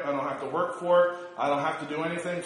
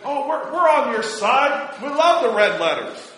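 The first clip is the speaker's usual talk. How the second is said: mocking; 7 semitones higher, 6 dB louder than usual. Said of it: "the world would try to convince us that".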